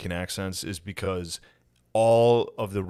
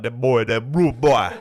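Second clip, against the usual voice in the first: put-on Jamaican accent; 2 semitones higher, 7 dB louder than usual. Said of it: "you're allowed to say the bum clot".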